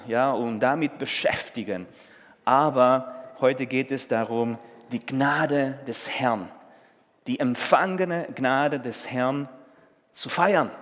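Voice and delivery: ordinary talk, nothing marked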